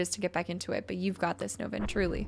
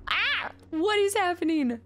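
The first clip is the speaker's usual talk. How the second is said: sudden high-pitched yelp of surprise; startled; 9 semitones higher than usual. Real, not acted. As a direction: caught off guard, voice jumping up. Sudden surprise during gameplay.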